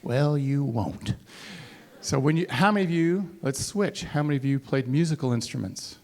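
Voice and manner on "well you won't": slow, unvoiced deadpan aside; slow whisper. Real, not acted. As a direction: drop to a whisper and drag it out. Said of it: "slow whispered punchline".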